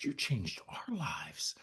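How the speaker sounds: slow, breathy whisper, close to tears; choked whisper. Real, not acted. Father answering his son in court, in a slow choked whisper. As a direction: barely get the words out. Emotional moment with adopted son.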